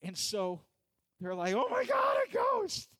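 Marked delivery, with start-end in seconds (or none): 1.5-2.9 high-pitched panicked shout; terrified disciples. Acted. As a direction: scream it out in sudden terror. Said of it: "It was scary, right?".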